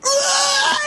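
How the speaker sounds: very high drawn-out mock scream; movie-style yell. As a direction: imitate a dramatic action-movie shriek. Imitates movie-style roaring moment.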